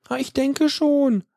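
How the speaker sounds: secretary answering the operator unsure and hesitant. Acted. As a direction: answer as if unsure what you're agreeing to. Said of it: "sagt sie unsicher".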